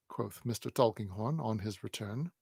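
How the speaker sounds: plain reading voice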